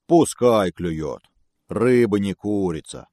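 slow, grumbling bear. Acted. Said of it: "проворчал медведь".

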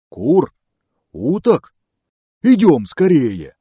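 loud, high, delighted bear; excited bear. Acted. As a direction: shout with greedy delight. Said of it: "обрадовался медведь".

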